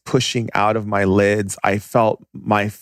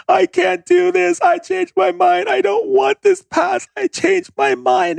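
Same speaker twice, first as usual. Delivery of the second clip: terrified screaming plea; slower than usual. Retold or imitated. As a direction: beg in terror as if dying. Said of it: "I was screaming in my head".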